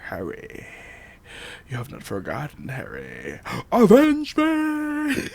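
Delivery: ghostly father voice, rising to a shout; villain voice. Retold or imitated. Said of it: "The voice is back".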